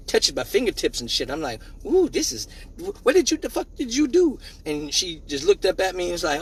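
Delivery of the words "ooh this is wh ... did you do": put-on amazed kid. Kid amazed after climax.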